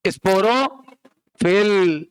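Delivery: Sporophyll stretched very slowly for the class; teacher prompting sporophyll, slow and spelled out; slow drilled term. Shown as a demonstration. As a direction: draw sporophyll out so students chant along. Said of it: "बोलो, स्पोरो".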